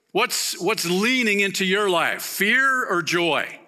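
high, loud, urgent preaching voice; impassioned sermon climax; preaching at full force. Acted. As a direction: drive the warning home from the pulpit. Preaching, then challenging listeners.

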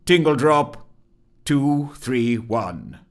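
loud official call-out; clerk's loud announcement, slow on the digits. Acted. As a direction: call the name out to the back room, drawing out the number. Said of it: "called out the clerk loudly".

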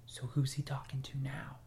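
worried hushed whisper, unvoiced. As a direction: whisper so the boy can't hear. Parent whispering about son.

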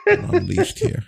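words through laughter; giggling out the words; laughing. Real, not acted. Laughing at food puns.

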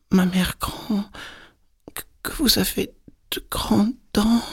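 put-on tiny, very high halting child voice; astonished little girl. A narrator's reading. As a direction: squeak it out haltingly in wonder. Girl's astonishment peaks.

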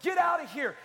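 alarmed, run-for-it shout; panicked shout. Retold or imitated. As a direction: cry out as a scared churchgoer. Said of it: "fear of them going".

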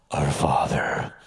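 slow, low and loud mock prayer; pompous, deep, drawn-out praying voice; put-on prayer voice. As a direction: intone it like a showy church pray-er. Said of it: "and the voice would change".

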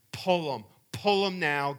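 father demanding the dentist pull the teeth, loud; urgent order. Retold or imitated. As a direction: tell the dentist to just do it now.

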